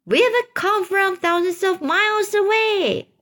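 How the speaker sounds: high, put-on calling voice; high-pitched storybook shout; shouting back. A narrator's reading. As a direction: call it back high, as if over a distance. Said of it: "shouted back".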